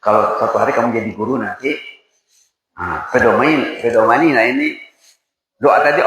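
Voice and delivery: usual speaking voice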